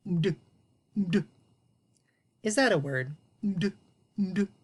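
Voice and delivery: "Md" with no vowel, lips closed; clipped "md". Shown as a demonstration. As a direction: hum "Md" without the vowel. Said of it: "What happens if my vowel wasn't there".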